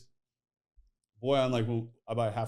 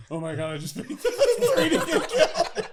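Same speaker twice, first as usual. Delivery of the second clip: much higher and louder, laughing through it; laughing mock shock; 10 dB louder than usual. Retelling Boyan's shock at the cows, laughing mock horror. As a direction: squeal it out between laughs.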